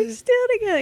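put-on high fast old lady voice; squeaky mock old woman; In Living Color old couple. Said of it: "that's a Chappelle thing".

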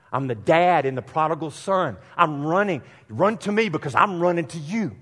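fervent preaching shout; rising shout. Retold or imitated. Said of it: "he's saying".

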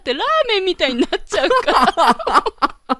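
giggling through the words; can't stop laughing; laughing fit. Real, not acted. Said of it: "ツボでハマって、ちょっと今、半泣きになってきました".

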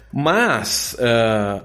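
usual speaking voice

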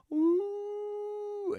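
long high wolf howl; wolf howl imitation. Mimicking the wolves joining the audio, high howl.

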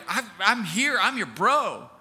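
loud, self-assured boast; swaggering loyalty, pushed out loudly; cocky, arrogant bravado. Acted. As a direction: play the overconfident best buddy. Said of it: "this arrogance of just like".

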